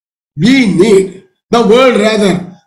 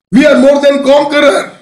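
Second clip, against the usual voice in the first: higher, louder, proclaimed in triumph; triumphant declaration; 5 semitones higher, 4 dB louder than usual. Retold or imitated. Preacher voicing Paul's triumphant boast to the congregation, proclaimed loudly. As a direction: ring it out like a victory. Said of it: "he says".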